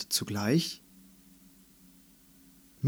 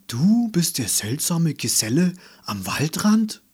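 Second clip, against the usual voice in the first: higher and louder, stunned; astonished gasp; 4 semitones higher, 4 dB louder than usual. A narrator's reading. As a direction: blurt it out in disbelief. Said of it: "keuchte Patsy". (erstaunt keuchend, höher und lauter)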